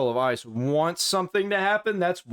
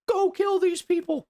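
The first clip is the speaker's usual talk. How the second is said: high-pitched mocking quote of the phrase "Go kill these people"; quoting himself, high; 12 semitones higher than usual. To YouTube, quoting the banned phrase in a high mocking voice. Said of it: "just because I said".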